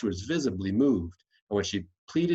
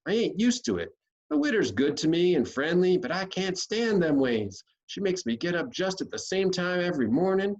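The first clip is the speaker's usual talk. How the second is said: put-on higher, complaining country boy; Huck's voice; 7 semitones higher than usual. Huck protesting to Tom about the widow. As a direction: whine it like a fed-up runaway boy.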